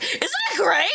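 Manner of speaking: high laughing exclaim; excited laugh; laughing delight. Real, not acted. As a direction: gush with a laugh. Delighted about clown look.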